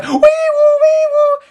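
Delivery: very high, slow wee-woo siren; high drawn-out mock siren; siren noise. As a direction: sing the alarm like a siren. Imitated alarm siren.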